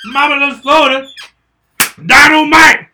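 high, very loud mock sitcom yell; shouted catchphrase. Friend clowning with sitcom lines, shouting. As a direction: blast it out like a TV catchphrase.

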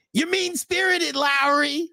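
very high, slow mock whine; Ralph impression. Host imitating Ralph scolding Lowry, very high whine. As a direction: squeak it like a mocking Ralph. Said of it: "trying to do the Ralph accent".

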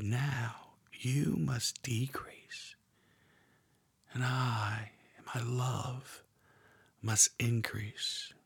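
slow, tender whisper of God's voice; God whispering gently, very slow. Acted. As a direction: breathe it like a secret to the heart. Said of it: "whispering to your heart".

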